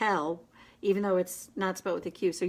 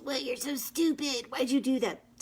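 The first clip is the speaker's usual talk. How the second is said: higher, scornful bully voice; punishing inner bully; 5 semitones higher than usual. Retold or imitated. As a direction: sneer at yourself like a bully. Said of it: "that punitive, punishing voice".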